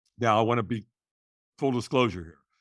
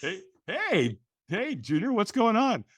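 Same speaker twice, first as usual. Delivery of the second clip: cheerful dad greeting; 9 semitones higher, slower than usual. Retold or imitated. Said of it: "quote of self calling home".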